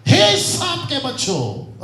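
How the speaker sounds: loud, high, drawn-out rebuke; scolding. Shown as a demonstration. Preacher showing the rebuke verse, loud and scolding. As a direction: thunder "हे" long and loud. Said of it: "डांट के पढ़ सकते".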